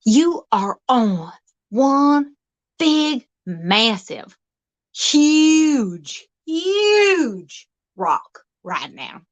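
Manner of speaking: slow and drawn out, building awe; slow dramatic reveal. Acted. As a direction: stretch it out like a big announcement.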